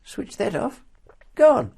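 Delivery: high, loud excited exclamations; mocking a trigger-happy person. Teasing a friend by imitating him, high and loud. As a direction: ham up a wild over-eager shooter. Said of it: "walks into a room and go".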